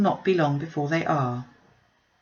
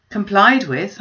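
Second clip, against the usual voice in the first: incredulous; 3 semitones higher, 8 dB louder, slower than usual. Acted. Father's incredulous retort.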